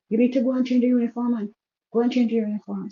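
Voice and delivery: retold angry father ordering a change of uniform. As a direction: snap the order at her, quick and sharp. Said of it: "he was a bit angry".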